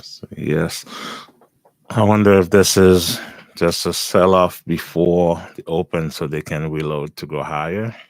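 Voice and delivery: ordinary talk, nothing marked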